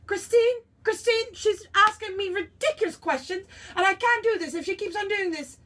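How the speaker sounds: high, loud diva complaint; exasperated calling out. Acted. Calls to an assistant about ridiculous questions, high and exasperated.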